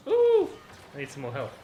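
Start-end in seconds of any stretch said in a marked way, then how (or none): 0.1-0.5 sudden high-pitched ooh; startled yelp. Real, not acted. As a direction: yelp as you get hit. Hit in game, high yelp.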